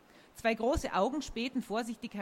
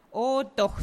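louder, higher contradiction; hissed retort; 4 semitones higher, 7 dB louder than usual. A narrator's reading. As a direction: insist forcefully, a touch cross. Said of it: "zischte Sophie".

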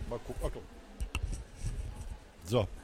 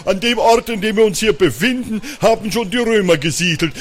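deep voice; 3 semitones higher, 3 dB louder than usual. Acted. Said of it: "rief mit tiefer Stimme".

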